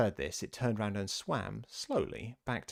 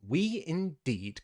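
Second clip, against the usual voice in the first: indignant mouse; 6 semitones higher, much slower than usual. A narrator's reading. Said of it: "cried the mouse, who was trembling".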